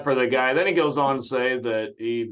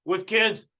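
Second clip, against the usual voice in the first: mocking host; 6 semitones higher, 4 dB louder than usual. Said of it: "Right, John?".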